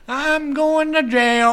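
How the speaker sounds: high, loud, drawn-out wail; imitated yelling dude. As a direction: stretch it out like the man hollering outside. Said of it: "a dude outside the apartment saying".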